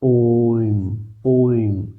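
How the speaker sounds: ओम with the ओ held long; pluta om. Shown as a demonstration. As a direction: draw out ओम over three beats. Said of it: "लेकिन लिखने में". (ओम को तीन मात्रा तक लंबा खींचकर)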